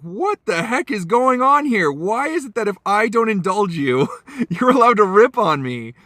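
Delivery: high-pitched, incredulous retort, acted out; exasperated protest. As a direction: protest in mock outrage at being insulted. Retold exasperated reaction to insult.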